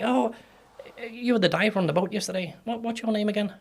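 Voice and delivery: high, friendly mimicked small talk; chatty downtown local. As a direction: imitate a curious local greeting someone they half know. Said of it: "people would say".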